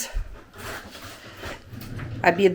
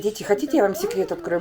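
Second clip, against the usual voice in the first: usual speaking voice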